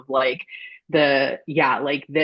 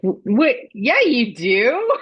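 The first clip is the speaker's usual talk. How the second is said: incredulous; 8 semitones higher, 5 dB louder, much slower than usual. Retold or imitated. Own reaction to Haymitch's claim.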